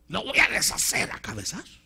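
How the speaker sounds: high-pitched, hot-headed threat; mock bravado. Preacher imitating a hothead attacking the goad, mock bravado. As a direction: act out someone spoiling for a fight. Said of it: "bravo, y agarres a, a, a cabezazos".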